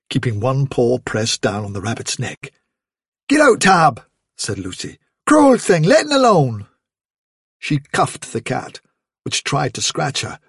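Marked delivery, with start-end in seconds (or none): 3.3-4.0 higher, louder scolding of the cat; a girl angrily shooing a cat; angry scold. Acted. Girl shooing cruel cat away. 5.3-6.7 high, loud indignant scolding; angry scold. Acted. Girl scolding the cat.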